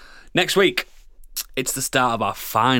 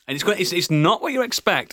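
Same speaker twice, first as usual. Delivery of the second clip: ordinary talk, nothing marked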